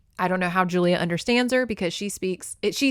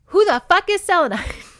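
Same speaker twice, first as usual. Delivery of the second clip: outraged shout; 10 semitones higher, 9 dB louder than usual. Real, not acted. Outraged reaction to book twist.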